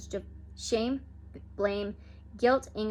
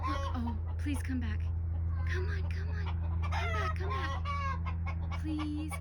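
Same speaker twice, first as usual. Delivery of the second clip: high, soft, drawn-out begging; softly whining please; pleading; 8 semitones higher, 4 dB quieter, slower than usual. Real, not acted. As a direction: plead with the bird to come back. Begging hawk to return.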